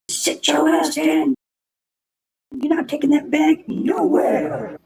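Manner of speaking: high, drawn-out cartoon officer holler; Foghorn Leghorn. Officers stopping him taking his bag, high slow cartoon holler. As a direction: mimic a bossy officer, high and stretched out. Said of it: "they do sound like Foghorn Leghorn".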